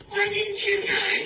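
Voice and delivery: very high squeaky question; mock squeal. Mouse teasing the snake, in a high squeak.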